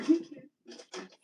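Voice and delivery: laughing loudly, she repeats her motto to viewers. Pretend. Loud laughing call.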